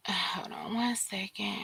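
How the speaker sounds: hushed aside, barely voiced; whispering. Real, not acted. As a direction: whisper it off to the side. Aside while looking something up.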